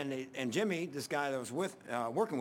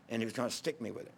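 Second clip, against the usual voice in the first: ordinary delivery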